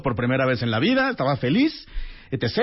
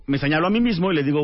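ordinary delivery

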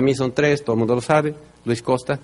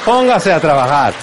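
self-scolding shout; 3 semitones higher, 5 dB louder than usual. Retold or imitated. Scolding himself into working.

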